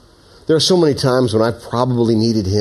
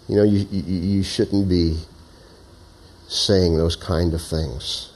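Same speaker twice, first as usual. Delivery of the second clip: gentle prompting; 4 semitones lower, 4 dB quieter than usual. Acted. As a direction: coax gently, hesitant and unhurried. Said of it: "kind of like a very gentle prompting or wooing".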